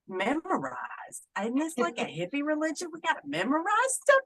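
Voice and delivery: high, quick mock disbelief; retold young trainee balking, pitch climbing; incredulous protest. Retells balking at priest's order.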